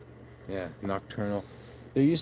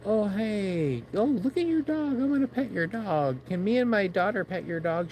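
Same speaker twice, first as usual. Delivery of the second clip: high, sugary voice of a passer-by wanting to pet; mock eager stranger; 9 semitones higher than usual. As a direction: gush over the dog in a high voice. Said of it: "When people go".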